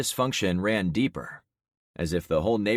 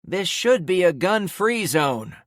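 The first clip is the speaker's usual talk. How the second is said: slow, raised cry from the back; cried out; 12 semitones higher, 7 dB louder than usual. Acted. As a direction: call it out across the crowd. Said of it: "someone in the back cried out".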